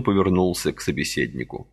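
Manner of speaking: ordinary delivery, nothing marked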